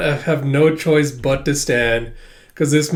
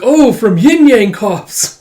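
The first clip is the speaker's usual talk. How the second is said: starstruck; 7 semitones higher, 8 dB louder, much slower than usual. Retold or imitated. Akane recognises the actor.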